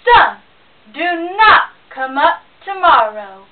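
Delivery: loud, slow bear order to the sun; booming command. A narrator's reading. Bear ordering the setting sun, loud and slow. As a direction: bellow at the sun like a king.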